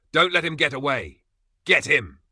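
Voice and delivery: high, loud call to attack; rallying shout. A narrator's reading. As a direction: yell at the others to grab him. Men rally with clubs.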